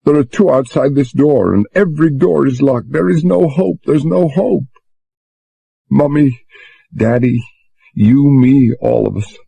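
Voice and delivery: a touch of sobbing despair; between sobs. A narrator's reading. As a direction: Susan giving up hope, mildly tearful. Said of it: "said between sobs".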